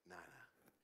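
low, soft dismissive nah; a calm divine no, very soft; God gently dismissing the pastor, low and quiet; God's quiet reply. Retold or imitated. God voiced answering the pastor.

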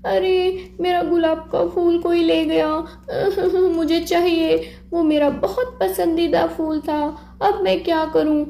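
a child's put-on tearful wail; crying girl. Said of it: "और वो रोने लगी".

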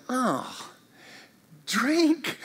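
soft, offhand shrug of a reply; mock indifferent. Listener shrugging off Jesus's call, mock dismissive. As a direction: toss it off like it means nothing. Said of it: "don't just go".

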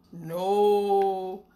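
a big beast bellows no; big animal voice. Acted. Beast in the piano booms no to the children.

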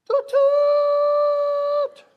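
very high, loud imitated train horn; piercing locomotive whistle imitation; yelled train whistle. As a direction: blast a short toot, then a long held one. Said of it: "I yelled".

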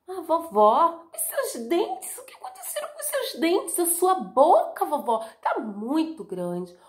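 put-on a little girl growing alarmed; high, trembling wonder; scared girl. Girl frightened by teeth.

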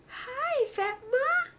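very high, sing-song hello. Real, not acted. Greeting her toddler on FaceTime.

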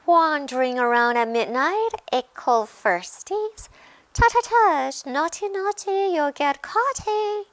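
high, loud mischievous poltergeist voice; cackling taunt. A narrator's reading. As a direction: tease them in a squeaky sing-song. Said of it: "Peeves cackled".

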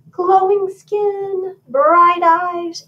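high, drawn-out queenly wishing; wishful queen. A narrator's reading. Queen describes her dream child to godmother, high and wishful. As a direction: stretch each wish out high.

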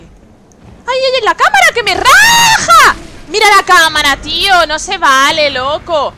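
high screamed protest, words drawn out; grito indignado. Real, not acted. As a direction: scream in outrage at the unfair hit. Game camera gets them killed. (gritar desesperado protestando)

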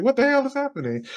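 quick, high-pitched alarmed question; retold bewilderment. Child watching mother's breakdown, bewildered high outburst. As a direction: blurt it fast and high, baffled.

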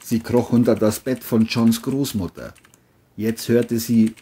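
usual speaking voice

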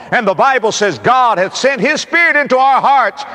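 high, forceful preaching; ringing pulpit delivery. Real, not acted. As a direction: proclaim it to the congregation with rising force.

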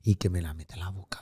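whispered scandalous confession; hushed gossip. Real, not acted. Confides scandalous detail.

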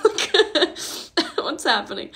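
breathy teary laughter over the words; real tearful laughter; laughing through tears. Real, not acted. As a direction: laugh helplessly through the crying. Laughing while crying.

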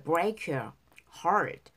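"break your heart" with break and your linked, the t of "heart" barely said; linked, soft final t. Shown as a demonstration. Teacher showing students the linking, soft t. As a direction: run the words together and let the last t fade. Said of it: "트 발음 약하게".

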